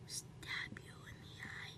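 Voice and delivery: slow menacing whisper; whispering while hiding. Real, not acted. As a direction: breathe a slow threat. Toy voice, whispered.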